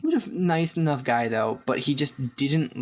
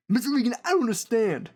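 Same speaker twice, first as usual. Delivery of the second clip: meathead strongman voice, raised pitch; Brandon; 8 semitones higher than usual. Retold or imitated. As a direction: Schwarzenegger without the accent, flustered. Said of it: "sounded like Arnold Schwarzenegger if he didn't have an accent".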